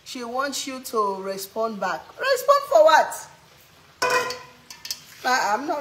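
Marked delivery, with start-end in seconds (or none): 2.2-3.3 high, loud incredulous question; raised-pitch, slowed disbelief; indignant. Real, not acted. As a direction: throw the question back, drawn out and indignant. Indignant at chat's demand to respond.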